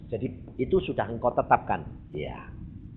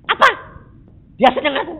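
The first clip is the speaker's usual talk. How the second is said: scornful; 13 semitones higher, 12 dB louder, much faster than usual. Retold or imitated. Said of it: "Dia bilang gini".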